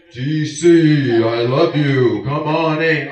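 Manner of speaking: deep, loud, slow divine voice; booming voice of God. Pretend. Preacher mocking how we imagine God calls him. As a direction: boom it out like God from the sky. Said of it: "It's not like".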